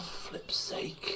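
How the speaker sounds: breathy whisper, slow; whispered exasperation. Real, not acted. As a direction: hiss it under your breath. Exasperated aside.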